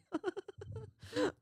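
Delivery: a high, breathy burst of giggling at the joke; high giggle. Real, not acted. Laughing at a viewer's comment during the livestream, a high giggle. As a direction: giggle up high, caught off guard.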